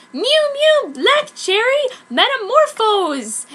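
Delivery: put-on magical girl transformation call. Sakura transforming into a Mew Mew. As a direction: shout the spell with full power.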